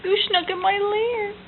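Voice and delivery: high, whiny bear voice; bear's complaint. Acted. Parent voicing the grumpy bear for toddler, whiny. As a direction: whine it like a wronged bear.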